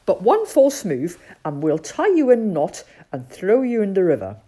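loud, firm captain's warning; calling out. Acted. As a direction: call out terms to a suspect newcomer. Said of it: "he called".

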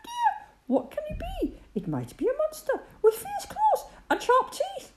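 very high, squeaking scared mice; squeaky frightened mice. A narrator's reading. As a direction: squeak in tiny frightened voices. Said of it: "the mice all started to squeak".

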